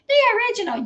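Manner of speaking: high-pitched incredulous echo; scornful mocking quote. Commentator throwing a daughter's comeback back at her, scornfully mocking. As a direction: toss the comeback back in mockery.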